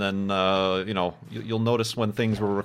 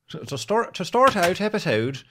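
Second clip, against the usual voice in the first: light, higher-pitched Irish lilt; Irish accent; 5 semitones higher than usual. Real, not acted. Host fumbling the episode intro into an accidental Irish accent. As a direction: slip playfully into an Irish accent. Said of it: "defaulting to an Irish accent".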